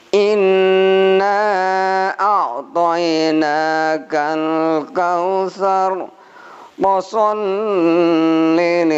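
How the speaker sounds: slow, high, chanted Quran recitation; Quranic recitation. Shown as a demonstration. Teacher recites Al-Kausar slowly to the class. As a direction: intone each verse slowly and melodically.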